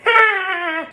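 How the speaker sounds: high character laugh; Chester's laugh. Shown as a demonstration. Showing Chester's own laugh, a high cackle. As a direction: give the puppet's own laugh. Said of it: "remember that when I'm laughing myself".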